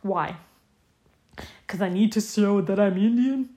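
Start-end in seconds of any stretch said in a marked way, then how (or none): 1.7-3.5 louder, mock Indian accent; put-on Indian accent. Podcaster mocking demands on brown actors, put-on Indian accent. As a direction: exaggerate an Indian accent as a sarcastic question. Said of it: "they want them to make an accent".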